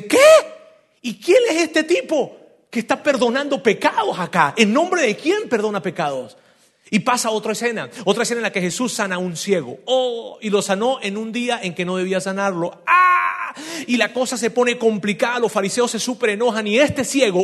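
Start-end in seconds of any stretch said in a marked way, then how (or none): 0.0-6.4 fariseos escandalizados. Retold or imitated. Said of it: "los fariseos dicen". 12.9-13.5 grito agudo y fuerte de asombro; exclamación. Acted. Reacción dramática del predicador.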